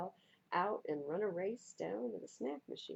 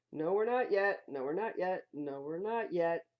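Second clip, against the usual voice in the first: weary parents; 6 dB louder than usual. Acted. Parents answering kids.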